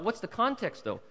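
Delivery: ordinary talk, nothing marked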